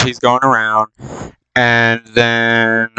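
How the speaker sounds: ordinary delivery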